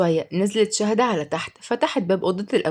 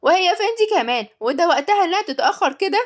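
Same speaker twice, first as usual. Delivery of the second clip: put-on high, louder irritated boy; annoyed; 10 semitones higher, 3 dB louder than usual. A narrator's reading. Boy fuming about the late nanny, annoyed and high. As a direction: complain with indignation. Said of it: "قال عمر بغيظ".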